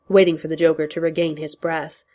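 ordinary delivery

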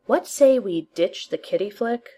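ordinary delivery

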